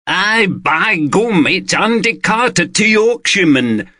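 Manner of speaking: a high, hammed-up mock Yorkshire accent, slowly; clumsy Yorkshire parody. London friends teasing Carter, mock Yorkshire accent. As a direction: lay on a bad Yorkshire accent to tease. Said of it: "delivered in clumsy parodies of a Yorkshire accent".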